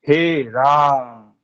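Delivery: "राम" stretched with a very long आ; drawn-out pluta vowel. Shown as a demonstration. Teacher demonstrating to students, "हे राम" drawn out long. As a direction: pull the vowel in "हे राम" out to three beats. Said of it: "उसको लंबा खींचेंगे".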